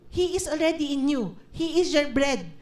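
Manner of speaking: ordinary talk, nothing marked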